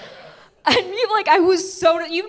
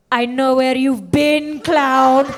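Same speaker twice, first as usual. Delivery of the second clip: slow, lightly voiced menacing mom; accusing mother; 5 dB louder, much slower than usual. Mom catching daughter sneaking in, mock ominous accusation. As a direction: draw out the accusation, landing louder on the last word.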